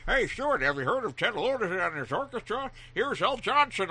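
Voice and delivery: loud, high-pitched excited dad; dad impression. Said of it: "my dad took out all the forty-fives and goes".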